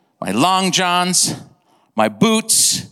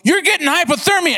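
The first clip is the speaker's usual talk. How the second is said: high-pitched imitation of a worried friend; acted alarm; 10 semitones higher than usual. Friend seeing the hunter's blue skin, acted alarm. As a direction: blurt the warning out in a raised pitch.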